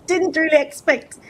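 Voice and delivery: high-pitched laugh while getting emotional. Real, not acted. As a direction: laugh through the welling emotion, voice way up.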